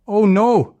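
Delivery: high, loud startled cry; suddenly see the wolf; frightened gasp. Acted. Leo spotting a real wolf, crying out in fright. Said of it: "Leo gasped".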